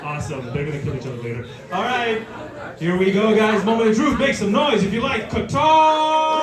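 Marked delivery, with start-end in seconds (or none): 2.8-6.4 booming motivational speech. Real, not acted. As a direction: build the crowd up. Host hyping the crowd.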